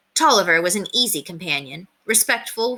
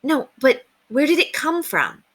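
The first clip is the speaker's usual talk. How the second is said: put-on boy's question; 8 semitones higher than usual. Boy William asks again.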